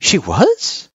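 put-on high, surprised child voice; taken aback. A narrator's reading. Girl learning mom suggested homeschooling, taken aback. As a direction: blurt it in high-pitched surprise. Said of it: "couldn't help but look taken aback".

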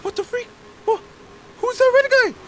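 high startled shout; frightened shriek. Real, not acted. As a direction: cry out in fright. Shocked by monster.

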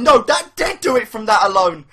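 ordinary talk, nothing marked

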